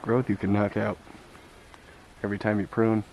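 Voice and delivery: winded after outdoor work; catching breath between phrases. Real, not acted. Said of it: "sorry, catching my breath".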